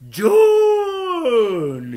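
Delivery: very high, long-drawn wailing shout; anguished last call. Acted. Grief-stricken Scott makes a last attempt, anguished wail. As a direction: stretch the name into one desperate cry. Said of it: "Copleșit de durere, Scott făcu o ultimă încercare".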